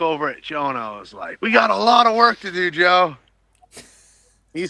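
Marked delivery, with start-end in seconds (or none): 1.4-3.2 higher, eager call to a friend; excited calling out. Retold or imitated. Diver on the ladder calling to Joe about work ahead, excited. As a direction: pitch it up as if calling over.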